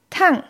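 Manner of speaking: Tang dropping sharply from high; fourth tone. Shown as a demonstration. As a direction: cut Tang down abruptly from the top. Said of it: "heiß". (Tang im vierten Ton, abrupt fallend)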